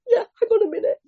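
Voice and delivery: very high, mock-incredulous scoff. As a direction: brush it off, half-laughing. Scoffs at reading a book.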